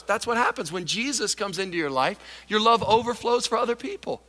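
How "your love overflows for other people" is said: normal talking voice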